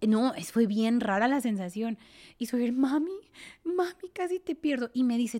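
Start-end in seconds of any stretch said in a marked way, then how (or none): 2.7-4.9 put-on higher, childlike frightened plea; son's scared voice. Her son crying that he almost lost her, higher child voice. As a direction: voice the little boy, scared.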